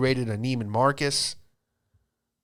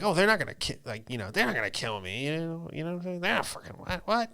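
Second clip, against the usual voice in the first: higher, cocky naive tourist voice, fading softer; mock naive tourist; 6 semitones higher, 4 dB quieter than usual. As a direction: play it as a clueless, cocky tourist. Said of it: "I'm like".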